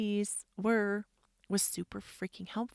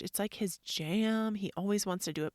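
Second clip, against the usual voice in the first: normal talking voice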